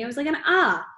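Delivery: kid's insistent imitation of his own yelp; arguing. A narrator's reading. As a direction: show the mock yell in protest. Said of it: "argued Jamie".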